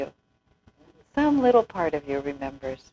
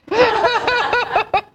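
laughing; 7 dB louder than usual. Real, not acted. Amused at Swamiji's retort.

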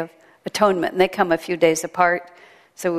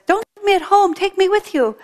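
much higher, begging parrot squawk; pleading parrot; 12 semitones higher, 3 dB louder than usual. Acted. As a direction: plead like a parrot left behind. Parrot begs in joke.

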